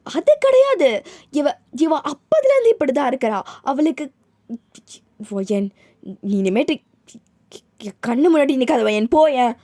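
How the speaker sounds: mother shrieking get out of my sight; screaming mother. Acted. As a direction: scream her out of your sight. Mother screams at daughter to leave.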